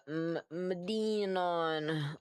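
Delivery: Madenon sounded very slowly with DEEN like Dean; drawn-out pronunciation. Shown as a demonstration. Streamer working out how to say a name, drawn-out pronunciation. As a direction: stretch out "ma-DEEEN-on". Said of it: "Like Dean from Gilmore Girls".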